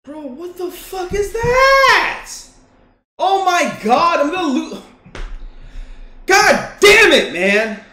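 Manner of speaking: loud, high, slow outburst; real frustration, shouting; frustrated yelling. Real, not acted. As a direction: rage at the loss.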